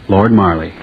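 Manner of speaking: usual speaking voice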